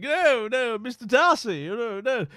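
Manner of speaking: high, loud, scandalised period-drama lady; Pride and Prejudice heroine. Pretend. Playing a lady refusing Mister Darcy's affections, hammed-up scandalised protest. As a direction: ham up a flustered costume-drama refusal. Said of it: "Is it sort of like Pride and Prejudice".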